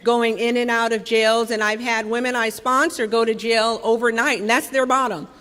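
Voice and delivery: ordinary talk, nothing marked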